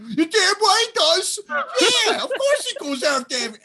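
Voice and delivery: high, loud mock retort in a put-on voice; imitating Bobby Fish. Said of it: "you just hear him in the background".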